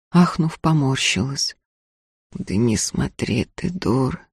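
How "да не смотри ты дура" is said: low hoarse grumble. Acted. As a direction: rasp it out quietly. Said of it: "хрипло сказал Ефим".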